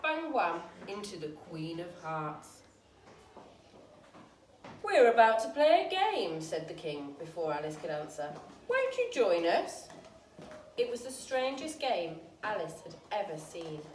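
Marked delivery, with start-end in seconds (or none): 4.8-6.4 loud, hearty king voice; king announcing. A narrator's reading. King loudly inviting Alice to play. 8.7-9.9 inviting king. A narrator's reading.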